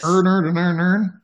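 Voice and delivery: high, loud mimicked Baltimore accent. Host imitating the guys in a funny video, Baltimore accent. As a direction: turn every word into the same "earn", Baltimore style. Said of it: "guys doing Baltimore accents and they're trying".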